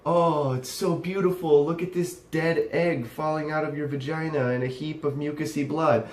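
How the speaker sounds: hammed-up swooning reverence; mock adoration. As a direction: pretend to be enraptured, dripping with sarcasm. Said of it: "I don't see it as".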